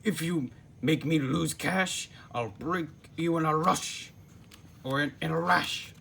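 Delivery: a cartoon boss threatening his worker, mock angry; snapping threat. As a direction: warn him, cross and menacing. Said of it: "he snapped".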